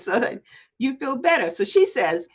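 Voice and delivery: ordinary talk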